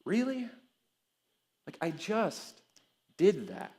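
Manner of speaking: high, soft "really?", then pointed pauses; incredulous God. Acted. Preacher voicing God answering our worry, quietly incredulous. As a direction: play God quietly baffled by forgetful people. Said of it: "he just looks at us and he goes".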